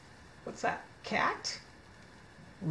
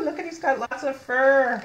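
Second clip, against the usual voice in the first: usual speaking voice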